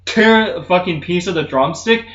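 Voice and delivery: usual speaking voice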